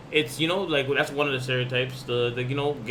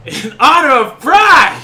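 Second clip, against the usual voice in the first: much higher, louder and slower, drawn out with flair; campy flourish; 10 semitones higher, 13 dB louder, slower than usual. Pretend. Podcast host re-announcing the Pride guest to listeners, campy and dramatic. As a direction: ham it up like a flamboyant Pride emcee.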